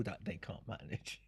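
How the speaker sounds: breathy whisper, like sharing a secret; whispered aside. Shown as a demonstration. Speaker mocking how managers judge them, whispered. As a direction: drop it to a conspiratorial whisper.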